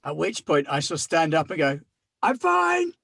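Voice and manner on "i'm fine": strained high-pitched fake cheer; high squeaky I'm fine. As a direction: squeak it out, pretending all is well. Character insists after falling.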